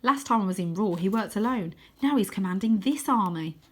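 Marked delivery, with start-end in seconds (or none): none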